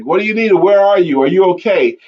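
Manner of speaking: imagined yell back. Retold or imitated. Imagined helper answering the lost soldier, mock yelling back. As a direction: imitate a rescuer calling out with questions. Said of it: "wanted was somebody to yell back".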